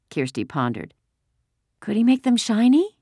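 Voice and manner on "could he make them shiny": put-on high small-child voice; childish question, pitched way up; little girl. A narrator's reading. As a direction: ask it like a hopeful little kid. Small girl asks about shoes.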